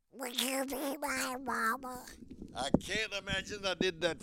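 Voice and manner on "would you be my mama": pleading little cartoon duck; high, slow duck squawk; Yankee Doodle. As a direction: plead like a sad duckling. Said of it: "looked up sadly and said".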